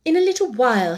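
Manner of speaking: ordinary delivery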